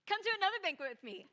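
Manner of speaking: high, loud, fast nervous blurt; nervous Esther. Retold or imitated. Esther to the king and Haman, nervous high blurt. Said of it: "she's like".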